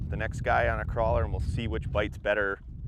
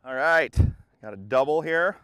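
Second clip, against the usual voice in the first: louder, thrilled call over the boat; real excitement at a double hookup; excited call; 4 semitones higher, 9 dB louder than usual. Real, not acted. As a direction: call out the double catch, pumped. Two fish hooked at once.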